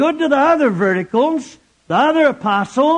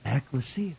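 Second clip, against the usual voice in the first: hushed reveal; 9 semitones lower, 13 dB quieter than usual. Acted. Dramatic hushed answer.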